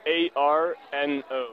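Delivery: A R N O spelled letter by letter, slowly; spelling it out. Real, not acted. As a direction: spell out A R N O one letter at a time. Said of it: "Spell it for me, please".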